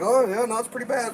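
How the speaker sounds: mock mild agreement. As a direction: play it down, in contrast to hysteria. Calm reaction against hysterical one.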